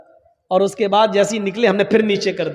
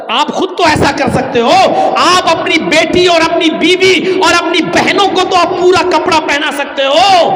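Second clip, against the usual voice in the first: higher, louder impassioned sermon; fiery preaching; 6 semitones higher, 5 dB louder than usual. Acted. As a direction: rebuke the congregation loudly. Preaching at full force.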